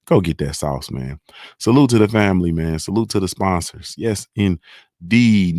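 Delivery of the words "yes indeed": slow, stretched-out "Yes, indeed"; drawn-out yes indeed. Real, not acted. Host stretching his catchphrase slowly after thanking sponsors. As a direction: let "indeed" hang long and slow.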